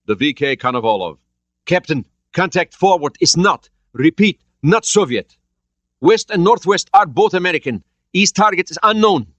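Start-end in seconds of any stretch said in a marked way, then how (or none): 1.7-9.3 urgent sonar report. A narrator's reading. Soviet sonar reports targets.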